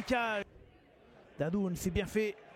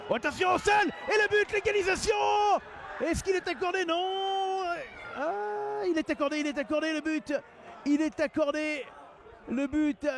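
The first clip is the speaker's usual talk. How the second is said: excited sports commentary; 4 semitones higher than usual. Real, not acted. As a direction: shout with relief as the goal stands. Equaliser, is it allowed.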